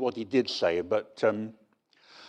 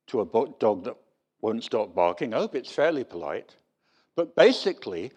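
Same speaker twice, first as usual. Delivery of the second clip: ordinary delivery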